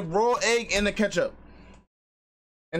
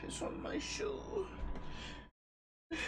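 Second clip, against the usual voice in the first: whisper; 12 semitones higher, 13 dB quieter than usual. Real, not acted. Muttering in disgust.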